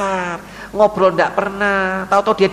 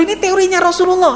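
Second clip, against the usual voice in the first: ordinary talk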